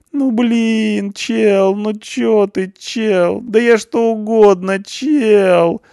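very high, whimpering plea; crushed and whimpering for mercy. A narrator's reading. Crushed punk whimpers to the barman. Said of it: "захныкал морально уничтоженный Панк".

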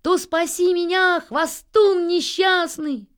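high, loud, stretched-out yell; jeering shout. A narrator's reading. Nils challenges the boastful pigeon to save him, high shout. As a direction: holler a taunting demand. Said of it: "закричал ему Нильс".

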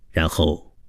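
ordinary delivery, nothing marked

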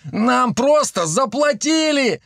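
high drawn-out whimper; whining. A narrator's reading. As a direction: whine it like a pleading excuse. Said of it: "захныкал третий". (плаксивое хныканье)

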